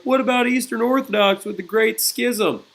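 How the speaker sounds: high-pitched mock student objection; objecting student. Student interrupting the history teacher, high mock objection. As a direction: imitate a student piping up with an objection. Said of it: "Some of you are like".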